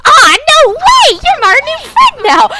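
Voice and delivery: high, loud squeal of joy; overjoyed child. Acted. Cherry hugging Roran, high and loud. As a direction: gush like a thrilled kid. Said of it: "Cherry rushes into Roran's arms and goes".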